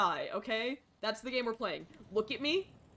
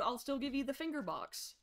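ordinary talk, nothing marked

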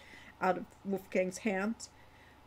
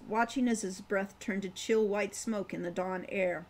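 plain reading voice

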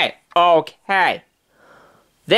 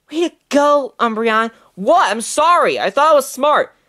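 ordinary talk, nothing marked

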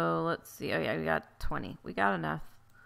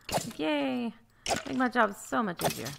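excited cheer; 5 semitones higher than usual. Real, not acted. Happy mobs come closer.

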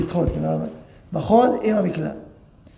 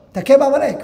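higher, louder battle charge; rousing command; 8 semitones higher, 7 dB louder than usual. Retold or imitated. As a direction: send him off to fight. Father's battle charge to son. (קריאת קרב נלהבת, גבוהה וחזקה)

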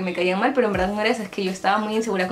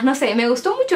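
usual speaking voice

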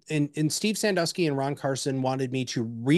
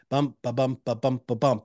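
"bump, ba bump" chanted in an even da-DUM beat; weak "ba", stronger "bump", in a steady pulse; rhythmic cadence; 2 semitones lower than usual. Shown as a demonstration. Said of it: "Your pentameter is that cadence".

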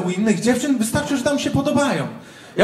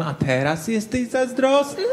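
put-on teasing girlfriend; 5 dB quieter than usual. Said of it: "odwraca się do mnie z ryjem".

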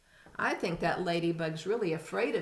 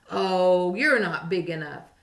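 grouchy dismissive; 8 dB louder than usual. A narrator's reading. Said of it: "said the grouchy ladybug".

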